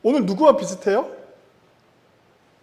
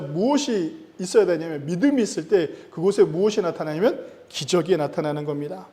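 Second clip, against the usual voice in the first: normal talking voice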